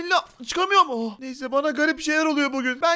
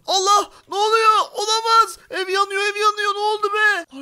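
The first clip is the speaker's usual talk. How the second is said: panicked; 6 semitones higher, 3 dB louder than usual. Acted. House catches fire, panic.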